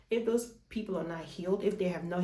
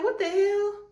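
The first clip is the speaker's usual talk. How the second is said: shrill high jump, louder, aghast; shocked outrage; 14 semitones higher, 5 dB louder, slower than usual. Retold or imitated. Retelling her reaction to Greg, high-pitched outrage. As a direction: squeal it in disbelief.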